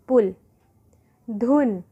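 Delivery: ordinary delivery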